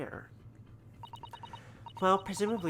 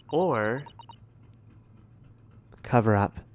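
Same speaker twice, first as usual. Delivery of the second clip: dramatic; 3 semitones lower, 10 dB louder, much slower than usual. Real, not acted. Dramatic accusation of cover-up.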